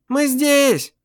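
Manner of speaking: high, calling to the police. A narrator's reading. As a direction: wave and call to them. Waving to police.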